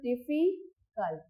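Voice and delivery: slow "difficult", split as डिफि, then cult; syllable by syllable. Shown as a demonstration. Teacher giving learners an example, "difficult" slowly split in two. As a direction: break "difficult" into two spoken chunks. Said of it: "दो syllable का use होता है".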